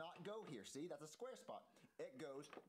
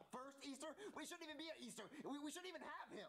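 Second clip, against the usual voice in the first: high, whiny, upset protest; indignant whine; 10 semitones higher than usual. Acted. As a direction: defend yourself in a teary huff. Mario protests adopting Jeffy.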